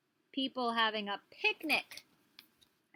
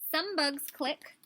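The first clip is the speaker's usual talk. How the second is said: put-on reading to children; 6 semitones higher, 6 dB louder, slower than usual. Reading book aloud.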